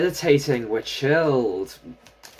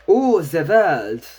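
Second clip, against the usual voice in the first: slow, drawn-out anime catchphrase, lightly acted; Dio; 3 semitones higher, 5 dB louder, much slower than usual. Tarot reader quoting a meme on seeing the card, mock-dramatic. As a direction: stretch it out, louder, as a meme quote. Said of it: "should have done that in a deeper voice like JoJo's Bizarre Adventure Dio".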